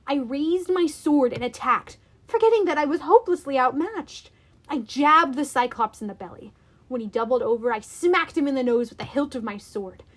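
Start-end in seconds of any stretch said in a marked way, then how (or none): none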